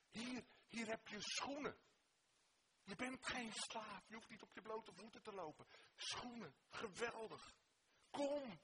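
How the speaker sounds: rising delight as gifts are handed over; joyful father. Acted. Father welcoming back his child. (blije vader, steeds uitbundiger)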